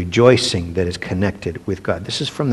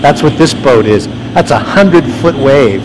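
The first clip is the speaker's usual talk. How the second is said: louder, higher, amazed at the huge wave; excited awe, raised voice; awed excitement; 5 semitones higher, 7 dB louder than usual. Real, not acted. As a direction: marvel out loud at the monster wave. Awed by hundred foot waves.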